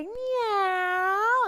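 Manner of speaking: high, loud meow; cat meow. Shown as a demonstration. Teacher has kids prowl like cats, loud high meow. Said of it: "Move like a cat and say".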